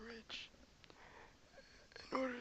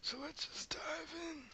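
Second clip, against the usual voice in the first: normal talking voice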